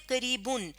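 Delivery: قریبن ending in a short u, "-bun"; pron with pesh: bun. Shown as a demonstration. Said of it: "اور اگر دو پیش ہوتے". (قریبن کا آخر پیش سے، "بُن")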